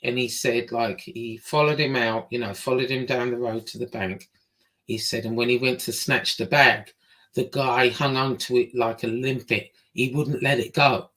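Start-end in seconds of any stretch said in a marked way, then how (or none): none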